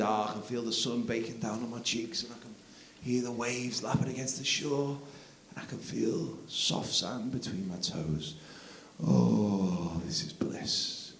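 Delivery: soft, slow blissful murmur; dreamy soft bliss. Retold or imitated. Dad dreaming of a desert island, soft slow blissful murmur. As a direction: drift off into paradise.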